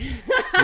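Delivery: loud laugh. Real, not acted. Wife laughs.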